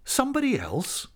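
high-pitched incredulous echo; astonished. A narrator's reading. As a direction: echo it in disbelief, voice leaping up. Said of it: "seemed to astonish him".

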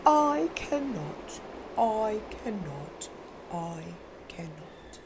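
slow, low chugging fade; old engine rumbling off, fading out; chugging refusal. Acted. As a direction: chug it away into the distance. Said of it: "off he rumbled to the roundhouse chugging".

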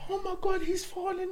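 high worried voice; wife panicking. Retold or imitated. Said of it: "my missus was like".

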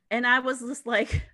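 ordinary talk, nothing marked

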